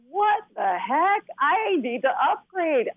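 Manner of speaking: high, drawn-out astonished reaction; retold amazement. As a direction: act out being amazed, stretching the words slowly. Retelling her amazed reaction to phones.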